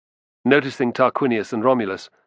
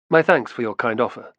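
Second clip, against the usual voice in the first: ordinary delivery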